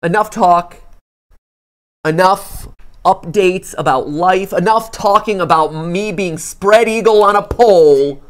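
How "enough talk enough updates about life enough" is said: dramatic announcer. Acted. Dramatic build-up to the game.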